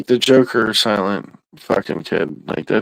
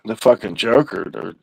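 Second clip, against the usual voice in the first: usual speaking voice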